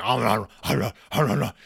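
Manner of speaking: mock gobbling noises; loud ah-ah chomping like Cookie Monster; imitating a greedy bear stuffing his face. Papa cramming cookies, imitated.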